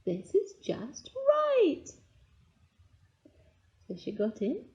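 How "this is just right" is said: high, drawn-out delight; delighted cry. A narrator's reading. Goldilocks has found the perfect bed, crying out in delight. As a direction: savour it slowly on a high pitch. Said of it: "she cried".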